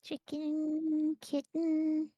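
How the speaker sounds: put-on baby talk. Cooing at game pet.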